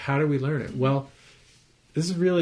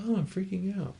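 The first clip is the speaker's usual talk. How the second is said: just observe it with a light, easy acknowledgement; calm noticing; 8 semitones higher than usual. Shown as a demonstration. Teacher showing how to catch yourself freaking out, gently and calmly. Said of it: "just go".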